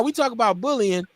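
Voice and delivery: normal talking voice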